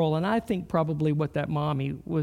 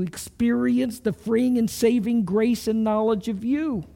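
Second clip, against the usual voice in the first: louder, earnest congregational prayer; public prayer; 2 semitones higher, 4 dB louder than usual. Real, not acted. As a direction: lead the room in prayer, voice lifted.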